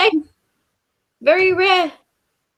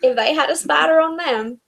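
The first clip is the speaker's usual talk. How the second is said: put-on Southern American accent. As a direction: say it like you're from the US South. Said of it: "you sounded like you were from the South".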